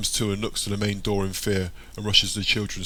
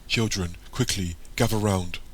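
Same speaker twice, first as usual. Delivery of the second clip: ordinary delivery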